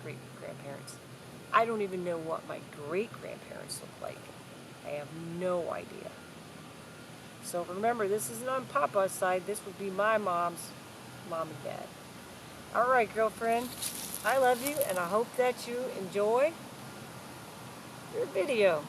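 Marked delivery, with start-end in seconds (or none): none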